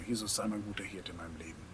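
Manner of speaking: ordinary delivery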